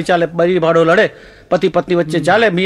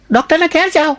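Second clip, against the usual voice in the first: high-pitched imitation of a wife; raised, insistent wife voice; wife's voice; 9 semitones higher than usual. Wife answers his lost voice.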